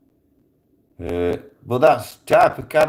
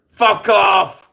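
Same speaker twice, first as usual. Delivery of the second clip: high, loud, explosive dismissal; re-enacted yell to send them running; angry shout; 12 semitones higher, 8 dB louder than usual. Gunman chasing off the crew, angry shout. Retold yelling at crew to leave.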